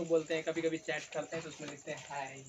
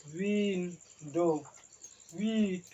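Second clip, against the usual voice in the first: slow stretched demo; 3 dB louder, much slower than usual. Shown as a demonstration. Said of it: "और एक window".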